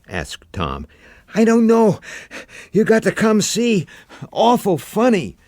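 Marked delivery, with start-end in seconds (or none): 1.3-5.4 put-on much higher, louder child's voice; a kid uneasy and insistent; young boy, spooked. A narrator's reading.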